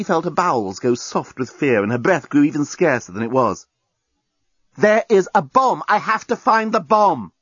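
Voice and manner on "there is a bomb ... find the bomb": high-pitched, emphatic urgency; urgent insistence. A narrator's reading. Journalist tells captive about the bomb, urgently insistent. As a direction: drive each word home urgently.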